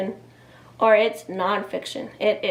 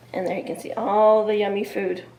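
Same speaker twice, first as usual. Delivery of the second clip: ordinary delivery, nothing marked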